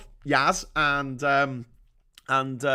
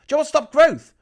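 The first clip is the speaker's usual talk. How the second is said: higher and louder rhetorical yell; furious rhetorical question; angry shout; 10 semitones higher, 9 dB louder, faster than usual. Real, not acted. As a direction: demand it loudly. Ranting again.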